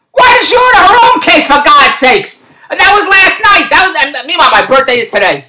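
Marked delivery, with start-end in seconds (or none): 0.1-2.5 high-pitched indignant rant; fed-up comic outburst; exasperated outburst. Real, not acted. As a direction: vent in exasperation, pitch jumping up. Ranting about the birthday cake.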